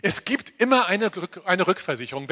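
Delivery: normal talking voice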